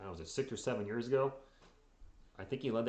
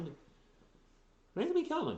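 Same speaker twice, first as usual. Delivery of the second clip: high, slow goofy voice; silly high voice; slower than usual. Acted. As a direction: drag it out in a goofy falsetto. Repeats answer in a silly voice.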